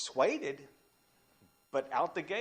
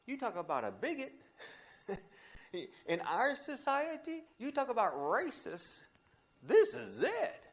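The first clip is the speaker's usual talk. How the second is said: high, loud, slowing on the last words; preaching climax; 11 semitones higher, 4 dB louder than usual. Real, not acted. Preacher naming racism to the church, loud and rising. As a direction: hammer home the point. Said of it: "preaching climax".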